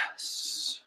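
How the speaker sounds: breathy unvoiced whisper; creepy whisper. Twice whispering to Toga, a creepy whisper. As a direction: barely voice a creepy yes.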